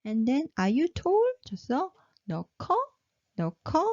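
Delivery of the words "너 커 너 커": 너 커 rising at the end as a question; question rise. Shown as a demonstration. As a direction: lift 커 upward to ask. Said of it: "raise the tone".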